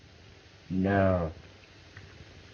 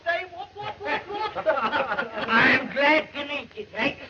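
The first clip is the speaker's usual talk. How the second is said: laughing; 11 semitones higher, slower than usual. Acted. Tipsy fellow greets Tex.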